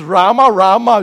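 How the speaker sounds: loud double call. Shown as a demonstration. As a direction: call the name twice, loud and sharp, like trouble. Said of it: "If it's".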